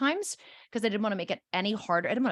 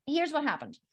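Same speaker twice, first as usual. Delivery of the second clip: usual speaking voice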